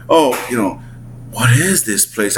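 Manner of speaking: usual speaking voice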